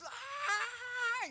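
high-pitched mimicked tantrum wail; child's tantrum scream. Dad imitating his daughter freaking out at church, high mock scream. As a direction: imitate a screaming little girl. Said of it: "she is just screaming".

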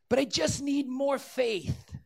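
high, drawn-out imitation of a plea; mock pleading believer. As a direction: mimic a needy believer's objection, slowly. Imagined objection from a believer.

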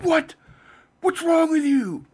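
high, loud mock shriek of disbelief; outraged fans. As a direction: yell it like an indignant fanboy, drawing it out. Acting outraged Wolverine fans.

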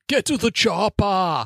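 put-on high, drawn-out Austrian-accented movie cry; Austrian-accented catchphrase. Host quoting the classic Predator line, hammed-up Austrian accent. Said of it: "ever been pronounced that way".

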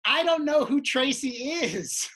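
high, loud, slow protest; exasperated. Retold or imitated. Performer answers shouting lady, exasperated. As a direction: spell it out for a heckler.

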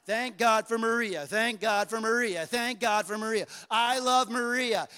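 imitating a child made to mean it; like you mean it. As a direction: hit each repetition harder, as ordered. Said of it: "say it like you mean it".

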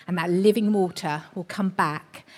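usual speaking voice

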